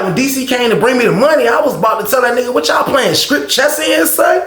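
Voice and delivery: high, climbing voice of disbelief; indignant gossip. Retold or imitated. Roommate says what he nearly asked the players, imitated indignant disbelief. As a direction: imitate a roommate getting worked up, pitch climbing to the end.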